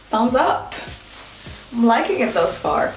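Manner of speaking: normal talking voice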